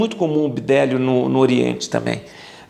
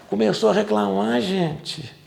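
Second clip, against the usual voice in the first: usual speaking voice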